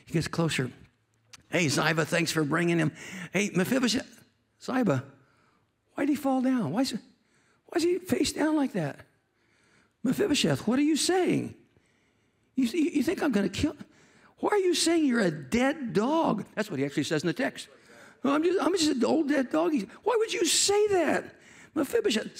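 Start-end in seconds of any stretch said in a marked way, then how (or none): 1.5-16.4 higher-pitched, puzzled king questioning his guests; David, baffled. Acted. King to Ziba and the fallen Mephibosheth, baffled concern. 18.2-19.7 high-pitched, grovelling reply; Mephibosheth, self-abasing. Acted. Mephibosheth to the king, self-abasing. Acts Mephibosheth belittling himself.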